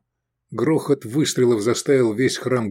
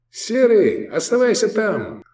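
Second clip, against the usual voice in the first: high shouted reply; pitched-up holler; calling out; 12 semitones higher than usual. A narrator's reading. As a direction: call to a mate across the hall. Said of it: "ответили ему".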